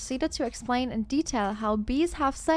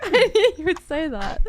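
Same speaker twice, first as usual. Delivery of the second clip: laughing; 5 semitones higher, 8 dB louder than usual. Real, not acted. Laughing at friend's answer.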